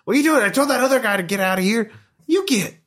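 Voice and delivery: territorial, high-pitched griping; angry scruffy squatter. Acted. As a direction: shoo off intruders in a high, cranky voice. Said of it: "he's looking very angry at you".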